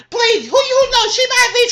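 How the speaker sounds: high, loud mocking cry of disbelief; dismissive yelp; scoffing outburst. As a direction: cry out at the absurdity. Scoffing at gossip.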